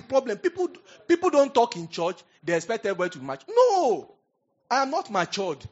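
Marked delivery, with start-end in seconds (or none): 3.6-5.6 loud, high burst of defiance; a sharp high cry of refusal; sudden outburst. Real, not acted.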